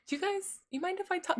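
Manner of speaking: small, high, soft voice, asking permission; sheepish streamer asking her own chat if she may talk; timid asking. Retold or imitated. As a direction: shrink and ask meekly to speak. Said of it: "and I'm like".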